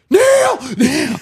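booming high shout; shouted command. Pretend. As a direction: bellow the command.

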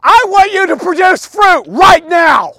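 loud, high, drawn-out shout; furious hammed-up yell. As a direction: bellow an order at a fruit tree. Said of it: "start yelling at it".

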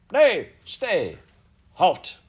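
loud, high commanding cry; ringing shout. A narrator's reading. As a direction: halt the guards with a ringing shout. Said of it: "her voice rang out".

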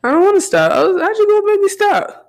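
high whimpering mock sob; fake crying. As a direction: pretend to cry, voice squeezed up high. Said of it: "This, this stop me in the middle of a sentence to fake cry".

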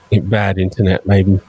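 usual speaking voice

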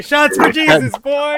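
high, loud toast; party cheer. Real, not acted.

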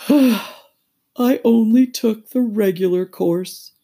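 high, slow, loud lament; drawn-out regretful turtle voice; sighing Mock Turtle. A narrator's reading. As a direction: sigh it out woefully. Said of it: "with a sigh".

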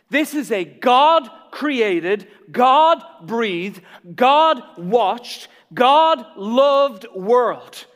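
slow, loud, every word hammered home; emphatic preaching. Real, not acted. Preacher proclaims to the congregation, slow loud build.

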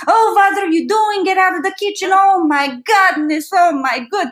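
put-on high, fussing old-lady voice shooing a child; grandma freaking out. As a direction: act a frantic grandma chasing a kid out of the kitchen. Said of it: "She was always freaking out".

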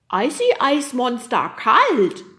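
slow, high, loud creature voice; proud yeti. A narrator's reading. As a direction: boast slowly like a childlike monster. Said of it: "nickte der Yeti stolz". (stolzer Yeti, laut, hoch und langsam)

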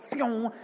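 high mock twang of a snapping branch; comic sound effect for the flying kitten; twangy branch sound. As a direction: fling out a cartoon twang.

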